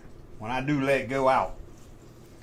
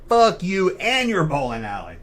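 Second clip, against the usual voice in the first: raised, higher mock yell; retold yell; 5 semitones higher, 4 dB louder than usual. Customer turning back on the lady, re-enacted yell. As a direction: re-enact yelling back at her. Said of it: "I was yelling at her".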